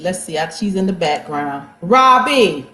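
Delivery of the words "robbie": loud call of a name. Real, not acted. Host summons the next guest from the background, calling out.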